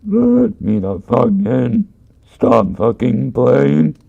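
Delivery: halting and slow, like a talking cat, not a person; halting, weirdly toned voice. Retold or imitated. Creature outside the door calling to be let in, halting and weirdly toned. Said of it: "in this halting, weirdly toned voice".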